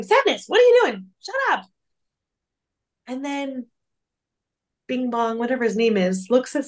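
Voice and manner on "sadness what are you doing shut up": high, loud exasperated outburst; exasperated Joy. Retold or imitated. Joy snapping at Sadness for comforting him, high and loud. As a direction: screech it up high at her teammate. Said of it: "Joy's over there going".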